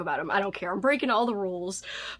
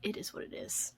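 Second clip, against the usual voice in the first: whisper; 12 dB quieter than usual. Real, not acted. Whispering over a spooky magnet.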